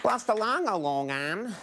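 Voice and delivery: nonsense syllables swooping high then dropping; mock garbled holler in singsong swoops; imitated garbled shout. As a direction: mimic an unintelligible audience member. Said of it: "It sounded like".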